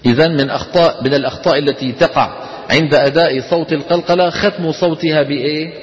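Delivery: usual speaking voice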